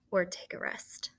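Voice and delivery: a hushed reminder under the breath; whispered aside. Shown as a demonstration. Teacher reminding students of the signal, whispered. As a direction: whisper "or take a rest" softly.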